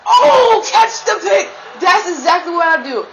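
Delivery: high, loud call to catch the pick; excited yell. Real, not acted. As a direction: shout at the player to grab it. Pick nearly caught, yelling.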